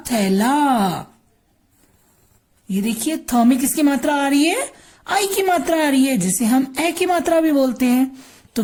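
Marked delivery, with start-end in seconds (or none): none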